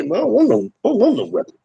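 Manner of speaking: mocking tough inmate. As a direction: scoff at him by playing the fake tough guy. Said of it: "run in the pen and say".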